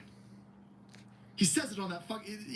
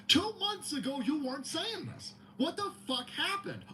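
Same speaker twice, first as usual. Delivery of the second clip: much higher, incredulous repeat; exasperated higher repeat; 9 semitones higher than usual. Real, not acted. Ranting at the campaign, incredulous and much higher. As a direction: throw the line up high in disbelief. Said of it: "uh, two months ago you weren't saying this".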